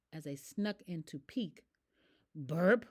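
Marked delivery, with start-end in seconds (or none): 2.3-2.8 loud mock burp; burp sound. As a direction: belch it out. Ostrich burps.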